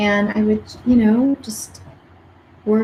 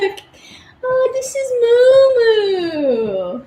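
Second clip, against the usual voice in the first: very high, very slow doting coo; cooing over pet; 14 semitones higher, 4 dB louder, much slower than usual. Real, not acted. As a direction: melt over the dog as you show her.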